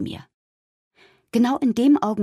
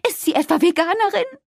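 very high-pitched horrified question; alarmed calling; 12 semitones higher, 4 dB louder than usual. A narrator's reading. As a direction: shriek the question as a scandalized mother. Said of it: "rief eine andere Mutter". (entsetztes, sehr hohes Rufen)

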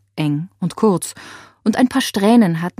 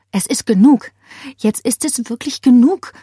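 ordinary delivery

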